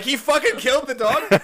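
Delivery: high, loud incredulous cry; scandalised, high-pitched outburst; mock shock. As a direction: shout it in comic disbelief. Acting out the class's shocked reaction.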